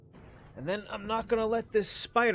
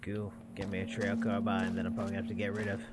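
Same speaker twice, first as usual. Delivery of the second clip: quiet mutter; 9 semitones lower, 7 dB quieter, faster than usual. Real, not acted. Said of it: "That I am gonna".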